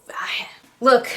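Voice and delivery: whisper. Real, not acted. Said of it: "it's gonna sound really mean".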